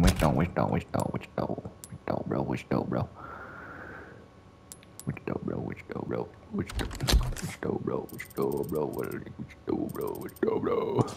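trailing off into a whisper; fading to a whisper. Real, not acted. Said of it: "repeated fading to whisper".

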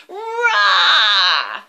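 put-on loud, high roaring shout for the kids to copy; a huge dragon roar; big roar. Shown as a demonstration. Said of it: "Can you roar for me?".

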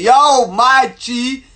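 loud, high, drawn-out greeting; mock shouting. Shown as a demonstration. Showing how he didn't want to greet her, shouting. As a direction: holler it long like a street hail. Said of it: "I didn't want to start shouting".

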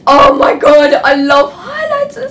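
high, loud gushing squeal; overcome with emotion. Real, not acted. Girl showing her school haul on camera, squealing with emotion. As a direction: gush like you might burst into tears. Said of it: "I'm getting emotional now".

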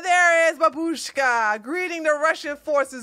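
imitating a soldier's gleeful hail; mock delighted greeting. Acting soldier faking a warm welcome.

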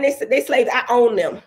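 ordinary talk

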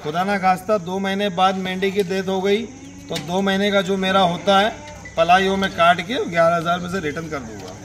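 normal talking voice

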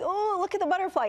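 put-on high, delighted child's exclamation; imitating her son's thrilled little voice; excited child. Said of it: "seeing my son be so excited and say".

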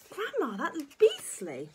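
put-on high, drawn-out child protest; disgusted boy. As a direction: protest in slow revulsion. George disgusted.

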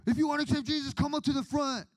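high, urgent preacher's call; altar-call pastor. Retold or imitated. Pastor inviting people forward after the sermon, high mock altar call. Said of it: "and then the pastor's like".

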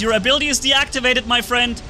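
loud, high gloating taunt. Real, not acted. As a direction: rub it in, laughing. Taunting enemy player.

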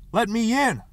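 loud, high demand; shouting at a locked door; yell. A narrator's reading. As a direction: yell to be let inside. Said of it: "he yelled".

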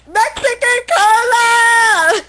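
high, loud, slow announcing shout; dramatic shout. Acted. Reader hypes the character Carlos, slow dramatic shout. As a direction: proclaim it like a ringmaster.

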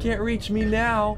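high, loud, drawn-out gloat; playful taunt. Pretend. Player gloating out of the enemy's reach, high drawling taunt. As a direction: sing out a high, slow jeer.

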